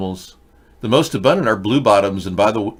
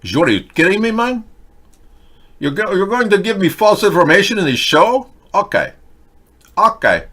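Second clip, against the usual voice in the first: loud, higher indignant rant; indignant outburst; 9 semitones higher, 4 dB louder than usual. Real, not acted. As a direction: blow up at the wrong date. Angry at false information.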